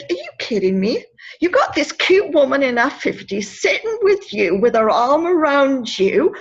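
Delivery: rising into emphatic mock indignation; playful disbelief. Woman cheering up a sad boy, playful mock indignation. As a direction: tease him with growing insistence.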